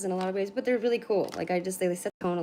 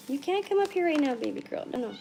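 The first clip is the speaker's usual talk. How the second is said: pet talk; 7 semitones higher than usual. Real, not acted. Cat climbing onto cards.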